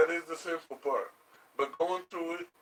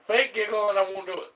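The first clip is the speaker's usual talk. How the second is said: teasing; 5 semitones higher, 7 dB louder than usual. Real, not acted. Teasing demand while tickling.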